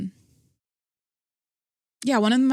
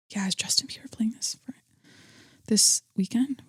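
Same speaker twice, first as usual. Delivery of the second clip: near-whispered question to oneself while checking; murmuring to oneself; 5 dB quieter than usual. Real, not acted. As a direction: read it under your breath as you look it up. Checking a date to herself.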